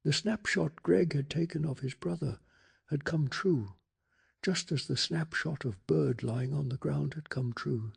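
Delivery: ordinary delivery, nothing marked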